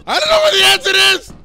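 scared yell, fast; frantic shout while lost; panicked yelling. Real, not acted. Panicked screaming in the dark.

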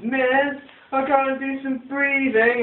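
high, pleading whine; whiny kid. Retold or imitated. Whiny kid asking the teacher for permission, high whining plea. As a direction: beg the teacher like a whiny child. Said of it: "The whiny ones".

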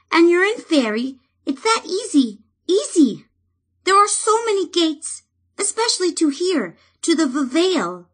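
high, stammering tiny sprite voice; a timid fairy cheering up; brighter fairy. Acted. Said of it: "brightened perceptibly".

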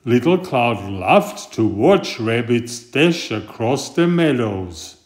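ordinary delivery

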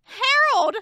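loud call of Harold's name; playful loud call. Real, not acted. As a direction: shout for Harold across the room. Calling out a name.

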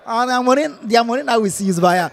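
ordinary talk, nothing marked

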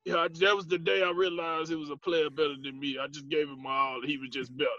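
soft, resigned ex-star admitting defeat, lightly voiced; a hint of a beaten veteran conceding; Charles Barkley impression. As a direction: quietly concede like a retired legend. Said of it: "you sound like Charles Barkley".

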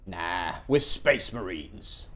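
slow, gruff soldier bravado, lightly done; Space Marine voice. Pretend. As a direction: drawl it like a swaggering space soldier. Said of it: "This is my Space Marine voice".

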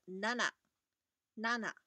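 nana said twice, clearly; Japanese nana. Shown as a demonstration. Teacher modelling seven as Japanese nana. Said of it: "第一个读音是nana".

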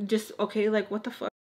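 ordinary delivery

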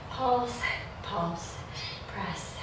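fading to a breathless whisper; breathless counting. Real, not acted. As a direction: run out of air on each rep. Counting reps while exhausted.

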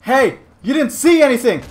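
high, loud challenge; threatening shout. Pretend. Killer to a witness, threatening shout. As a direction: yell at the witness.

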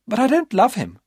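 put-on young woman hurrying to persuade; urgent high female voice; Maria's urgent voice.

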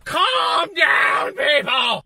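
hammed-up holler for order; exasperated shout. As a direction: yell it at an impatient crowd. Acted outburst at impatient crowd.

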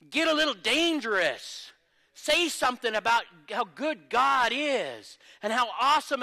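loud, high, slow and driving; fiery revival exhortation; preaching at full force. Acted. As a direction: shout it out to stir the crowd. Peak of sermon exhortation.

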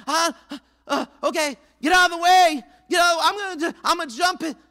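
loud, high burst of resolve; hesitant swimmer finally deciding to jump, loud and high; working up the nerve. Retold or imitated. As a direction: psych yourself up to jump.

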